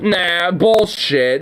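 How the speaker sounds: ordinary talk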